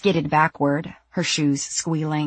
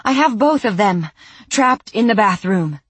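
louder, panting and higher; out of breath; 5 semitones higher, 6 dB louder than usual. Acted. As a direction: push the words out between breaths. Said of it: "she panted".

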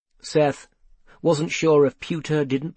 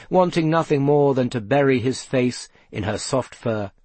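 ordinary delivery, nothing marked

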